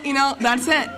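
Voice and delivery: normal talking voice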